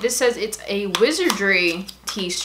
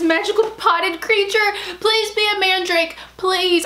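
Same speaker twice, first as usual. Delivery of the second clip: high eager begging; excited pleading; 8 semitones higher than usual. Real, not acted. Begging the box to be a mandrake, high and eager. Hoping aloud while reading.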